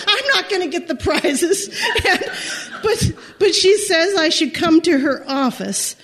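ordinary talk

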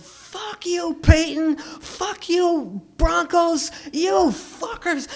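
high, loud jeering at the team; drunk fan yelling. Retold or imitated. Drunk fan heckling the Broncos, shrill mock yell. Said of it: "until she opened her mouth".

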